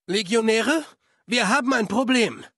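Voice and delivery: loud, high shout to the troops; calling out. Acted. Legion officer alerting his legionaries to trouble, loud, high shout. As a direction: bark the alarm across the camp.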